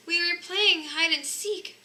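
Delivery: a guilty little cub explaining; cub's little voice. A narrator's reading. Cubs explaining in small voices.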